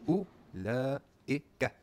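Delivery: Ulaika stretched very slowly, syllable by syllable; sounded out slowly. Shown as a demonstration. Teacher sounding out the long word for students, slow. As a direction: draw out every sound of Ulaika. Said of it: "this fourteen character word".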